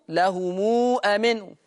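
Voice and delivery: normal talking voice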